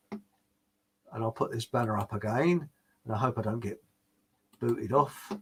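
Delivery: muttered worried aside; quiet aside. Real, not acted. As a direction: drop the voice for a wary aside. Aside about being banned online.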